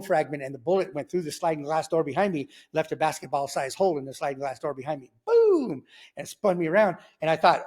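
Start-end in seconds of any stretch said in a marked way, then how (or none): 5.2-5.8 high, loud imitated gunshot; imitating the shot, high and loud; loud gunshot boom. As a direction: blurt it like a gun going off. Gunshot sound effect in story.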